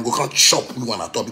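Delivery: ordinary talk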